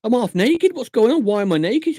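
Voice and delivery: high, loud, hammed-up panic; mock panic. Patient panicking at a needle, high and loud in mock panic. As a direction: play a patient panicking during a blood test. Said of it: "and you go".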